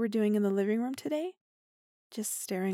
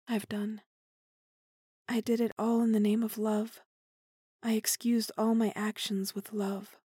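ordinary delivery

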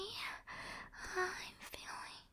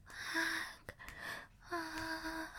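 ordinary delivery

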